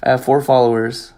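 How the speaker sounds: ordinary talk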